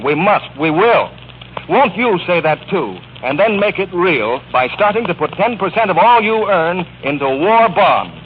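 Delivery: usual speaking voice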